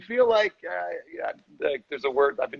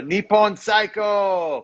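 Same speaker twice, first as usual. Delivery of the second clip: playful shout; 7 semitones higher, 4 dB louder, much slower than usual. Pretend. Playful shout of foreign phrase.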